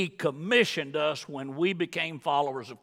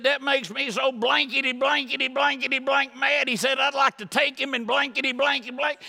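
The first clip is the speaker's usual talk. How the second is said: higher, raised voice of an angry friend, blanking out curses; mock fury; 9 semitones higher, 3 dB louder than usual. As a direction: play the friend's hammed-up rage. Friend retold as furious.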